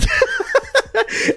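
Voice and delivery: real laugh. Real, not acted. Laughs at own punchline.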